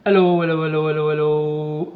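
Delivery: testing the CB, calling hello; radio mic check. Real, not acted. Keying the radio mic, calling hello.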